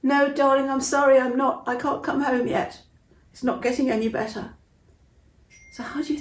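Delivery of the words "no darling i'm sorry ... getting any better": calling back sadly. Acted. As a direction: call back, then let it sink into sorrow. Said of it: "And she'd call up".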